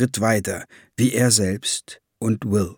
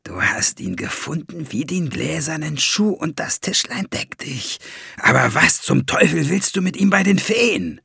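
higher, hushed start rising to demand; scheming dwarf in a raised voice; conspiratorial; 5 semitones higher than usual. A narrator's reading. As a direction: confide slyly, then challenge him. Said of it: "zwinkerte ihm verschwörerisch zu".

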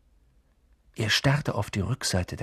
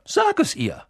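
put-on much higher, pressing girl's voice; urging Hermine. A narrator's reading. Hermine presses Neville to tell McGonagall, high and urgent. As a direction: insist he tell her. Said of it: "drängte ihn Hermine".

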